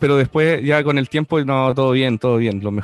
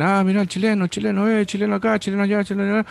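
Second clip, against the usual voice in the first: put-on higher teasing kid voice, singsong taunt; mocking schoolmates; 6 semitones higher, faster than usual. Argentine classmates teasing the Chilean boy, higher mocking chant. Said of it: "te decían".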